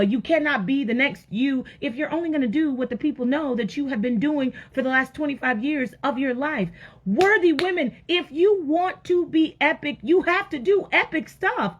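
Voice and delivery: high-pitched, emphatic exhortation; rousing preaching. Real, not acted. Preacher urging women to be epic, rousing exhortation. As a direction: preach it hard, pitch lifted.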